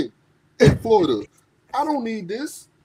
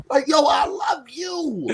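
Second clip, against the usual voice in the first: higher, slow shouted declaration; mock shout; 6 semitones higher, slower than usual. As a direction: belt it out slowly, half joking. Mock Roman declaring love.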